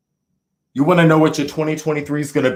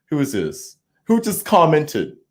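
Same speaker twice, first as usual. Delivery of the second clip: usual speaking voice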